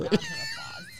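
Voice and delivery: high playful shout. Real, not acted. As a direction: cheer for yourself. Self-introduction shout.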